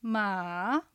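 ま dipping low, then rising; third tone. Shown as a demonstration. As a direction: sink ma low and soft, then lift ま. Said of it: "三声". (ま を低く抑えてから上げる第三声)

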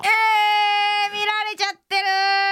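high, drawn-out mock-horrified wail; shocked outcry. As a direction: cry out as if caught in the act. Reacts to being spotted by a listener.

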